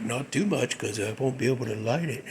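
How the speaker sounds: stoner buddy. Retold or imitated. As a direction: drop way down into the weed-hunting buddy.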